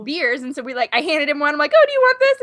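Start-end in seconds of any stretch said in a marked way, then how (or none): 1.8-2.4 high, loud mock-eager question; flustered offer. Guest hands the star a beer, flustered and eager. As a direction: imitate a starstruck fan offering something.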